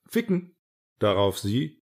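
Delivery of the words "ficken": gespielter Aufschrei. Acted. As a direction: blurt it out high and abrupt. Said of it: "Auf einmal schrie er". (plötzlicher, hoher gespielter Ausruf)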